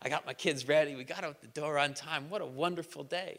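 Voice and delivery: lightly voiced smug bragger; smug bragging friend. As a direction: brag quietly about your perfect morning. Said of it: "There's the over-the-top person".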